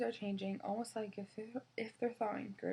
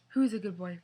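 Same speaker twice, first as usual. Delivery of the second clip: dog praise; 9 dB louder than usual. Acted. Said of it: "I ask".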